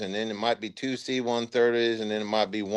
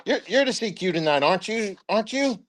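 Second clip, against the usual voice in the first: higher, pressing put-on voice, rising on the repeats; imitating the first sergeant; 9 semitones higher than usual. First sergeant pressing another soldier into duty, high insistent voice. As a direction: lean on the soldier until he agrees.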